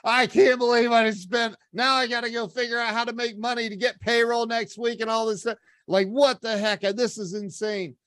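high-pitched exasperated rant to himself; pissed off. Retold or imitated. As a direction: rant in disbelief as the worries pile up. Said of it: "I'm pissed off".

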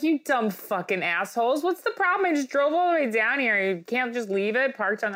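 high-pitched, indignant rant; mock outraged protest. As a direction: plead and berate in comic outrage. Acts out arguing with inspection staff.

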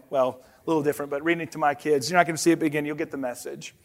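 ordinary delivery

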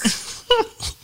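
high burst of laughter. Real, not acted. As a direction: laugh at your own story. Laughing after joke.

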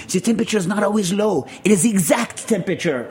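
hammed-up French accent; older Frenchman. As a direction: do an older Frenchman making a point. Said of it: "an older Frenchman voice".

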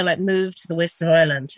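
ordinary delivery

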